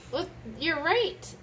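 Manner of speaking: usual speaking voice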